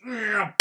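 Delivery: loud, high revving smash noise; explosive car-crash sound effect; imitated car crash. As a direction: imitate an engine roaring into a bang. Imitates a car roaring into impact.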